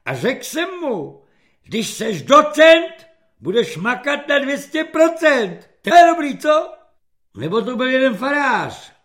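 high, loud, screechy bragging; boastful corporal. A narrator's reading. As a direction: brag loudly about breaking in recruits. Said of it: "chlubí se svými výchovnými úspěchy". (chlubivě, ječivě a nahlas)

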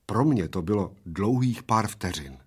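usual speaking voice

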